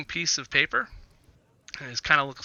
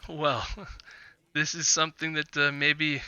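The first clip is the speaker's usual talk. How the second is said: lightly voiced Vistani trader, measured and hesitant; Vistani businessman; 4 dB quieter than usual. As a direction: play a guarded businessman, feeling out each phrase. Game master voices Vistani businessman.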